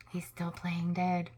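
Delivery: soft hushed whisper. A narrator's reading. Narrating the squirrel playing dead, whispered. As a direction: whisper so he is not found.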